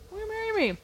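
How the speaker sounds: high, soft mock proposal; imagined stage proposal. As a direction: imitate a suitor popping the question.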